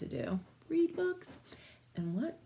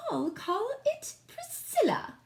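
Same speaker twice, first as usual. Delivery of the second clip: haughty queen; 13 semitones higher than usual. Acted. Queen names her baby.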